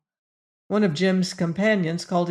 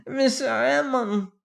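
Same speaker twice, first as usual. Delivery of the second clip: put-on high, accented bleat; 6 semitones higher than usual. A narrator's reading. French manager pleading with furious magnate, bleating. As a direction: bleat the name in supplication. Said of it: "he bleated".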